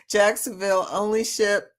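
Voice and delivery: usual speaking voice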